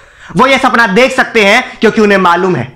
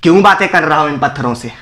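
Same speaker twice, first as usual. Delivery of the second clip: ordinary delivery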